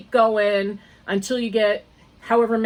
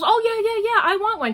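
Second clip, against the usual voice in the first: high, gushing imitation of the friend; eager friend; 13 semitones higher, 4 dB louder, faster than usual. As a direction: mimic her eager yes, pitched high. Friend eagerly agrees in story.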